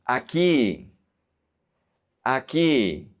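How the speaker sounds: Aquí as "ah-KEE", no w sound; silent U. Shown as a demonstration. Teacher modelling Spanish Q, Aquí with silent U. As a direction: drop the u in Aquí entirely. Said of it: "The U is silent".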